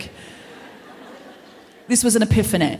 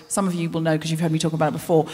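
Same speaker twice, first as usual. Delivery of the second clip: ordinary talk, nothing marked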